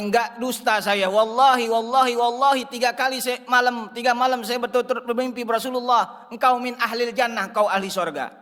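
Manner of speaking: acted earnest insistence, pitched high; high, pressing testimony; insistent oath. Syekh swears he is not lying.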